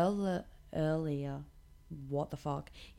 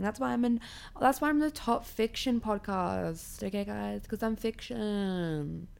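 sarcastic; 4 semitones higher than usual. Real, not acted. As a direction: brag about being fiction, clearly sarcastic. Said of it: "Can you hear the sarcasm in my voice?".

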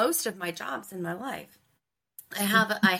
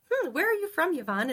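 surprised; 9 semitones higher than usual. Retold or imitated. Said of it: "she looks at me very surprised".

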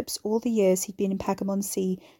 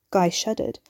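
ordinary delivery

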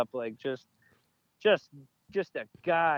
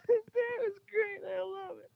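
squeaky, much higher put-on voice, slow. Praising his friend's impression in a high squeaky voice. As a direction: stretch it out in a silly high squeak. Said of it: "let me do the voice".

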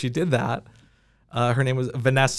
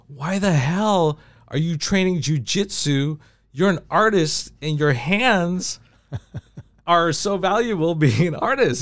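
slower, higher mock scolding of a manager; upset manager scolding; 4 semitones higher, slower than usual. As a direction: play the exasperated manager, half-mocking. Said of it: "my business manager was so upset".

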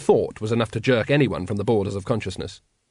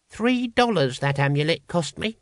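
higher-pitched character grumble; muttered; 6 semitones higher than usual. A narrator's reading. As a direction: grumble lightly about a loss, pitch raised. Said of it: "muttered Brother Dunnikin".

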